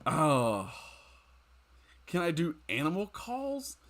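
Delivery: usual speaking voice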